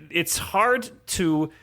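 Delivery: ordinary delivery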